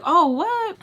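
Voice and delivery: high, loud retold surprise; surprised reaction. Influencer reacting to a cousin from school, surprised. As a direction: jump up in pitch with astonishment.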